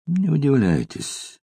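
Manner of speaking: bored. A narrator's reading. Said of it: "скучным голосом промолвил".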